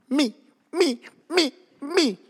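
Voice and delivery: high-pitched eager "pick me" voice; eager volunteering. Acted. Peter begging to walk on water, high and eager. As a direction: pipe up like an overeager volunteer.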